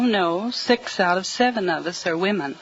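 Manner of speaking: ordinary talk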